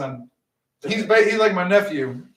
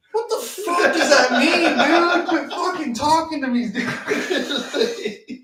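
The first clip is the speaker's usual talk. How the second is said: high, laughing and slowed at first; real laughter through the words; laughing disbelief; 7 semitones higher, much slower than usual. Real, not acted. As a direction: crack up at how absurd it is. Laughing over coworker's creepy joke.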